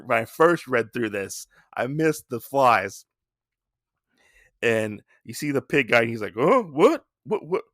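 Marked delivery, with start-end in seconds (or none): 6.4-7.6 higher-pitched mock bewilderment; stammering, taken-aback questions; very confused. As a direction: imitate someone totally baffled. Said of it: "he's acting very confused".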